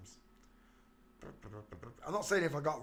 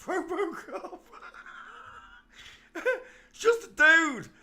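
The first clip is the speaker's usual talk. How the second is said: laughing; 10 dB louder, slower than usual. Real, not acted. Laughing at the photo.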